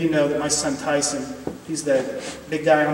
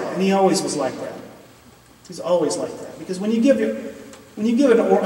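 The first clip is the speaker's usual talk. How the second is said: ordinary talk, nothing marked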